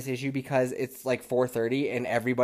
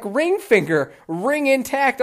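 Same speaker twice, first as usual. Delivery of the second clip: ordinary delivery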